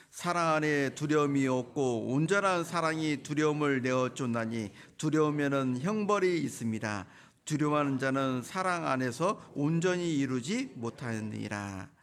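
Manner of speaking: loud, measured congregational reading; scripture reading aloud. Real, not acted. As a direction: lead everyone in reading the verse, drawing out the ending. Leads joint reading of scripture.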